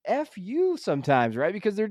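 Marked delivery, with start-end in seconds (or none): 0.1-0.8 much higher and slow, playful exasperation; drawn-out mock curse at the kids; mock fed-up parent. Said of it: "I'm just like".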